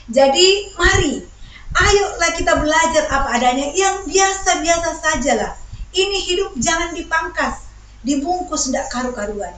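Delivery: rally them with a lifted voice; preaching exhortation. Real, not acted. Preacher urges congregation to live plainly, rousing exhortation.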